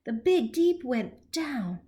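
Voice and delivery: ordinary delivery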